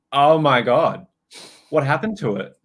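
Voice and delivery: retold burst of disbelief; astonished client. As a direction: exclaim it as the amazed client. Client's astonished reaction, acted.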